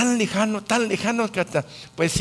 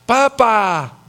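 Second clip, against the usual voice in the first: loud, higher call of Papá, Papá; mock child's loud call for dad; 5 semitones higher, 8 dB louder, much slower than usual. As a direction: call for dad across the house like an impatient kid. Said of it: "hasta así le dicen".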